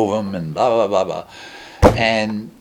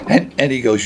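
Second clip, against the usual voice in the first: ordinary talk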